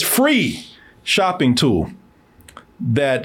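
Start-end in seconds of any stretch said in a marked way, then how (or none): 0.0-1.9 "free shopping tool" slowed right down, each word leaned on; deliberate emphasis on "free shopping tool"; stressed. Shown as a demonstration. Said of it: "and I stress".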